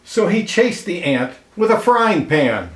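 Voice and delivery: lively read-aloud storyteller voice, clear and even; storybook narration. A narrator's reading. Reading a picture book aloud to children, lively storyteller voice. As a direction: tell it like a picture book for kids.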